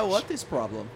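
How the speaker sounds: mock Russian accent. Russian agent reply.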